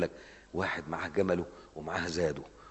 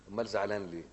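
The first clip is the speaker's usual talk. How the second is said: ordinary delivery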